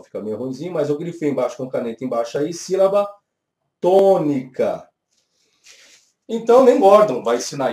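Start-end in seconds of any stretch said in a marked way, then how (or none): 2.5-4.9 "tônica" drawn out slowly and loud; emphatic key term. Shown as a demonstration. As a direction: pause after "sílaba", then stretch "tônica". Teacher stresses the underlined term.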